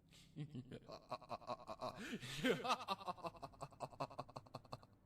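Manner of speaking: long, rising maniacal cackle; evil laugh. Acted. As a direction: laugh like a madman at his work. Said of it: "The cult laughed".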